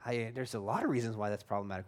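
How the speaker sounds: lightly voiced skeptic, dropped low and soft; mock objector. Preacher voicing a listener who sees the problem, low and quiet. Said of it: "You're like".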